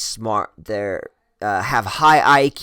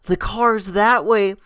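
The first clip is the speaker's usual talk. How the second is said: raised, high-pitched exasperated call; retold pleading shout down the street; calling after her; 7 semitones higher than usual. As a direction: call out to someone walking off ahead. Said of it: "chasing her".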